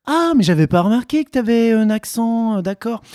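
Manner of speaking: high-pitched mock astonishment, settling into acceptance; imitated surprise. French listener noticing the guest's accent late, mock surprised. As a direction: play someone caught off guard, voice jumping up high. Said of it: "la personne va faire".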